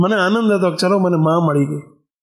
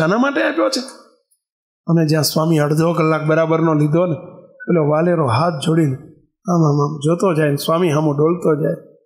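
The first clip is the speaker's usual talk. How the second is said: ordinary delivery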